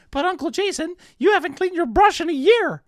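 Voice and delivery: put-on high, loud whiny kid; child voice. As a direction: play the cheeky little nephew. Voicing child Timmy.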